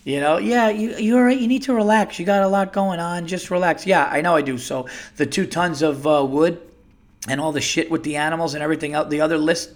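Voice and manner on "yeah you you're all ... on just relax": higher-pitched quoted advice, easing down; mock reassurance. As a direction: lightly imitate someone telling you to relax. Imagined soothing reply, acted back to him.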